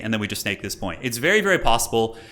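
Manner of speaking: ordinary delivery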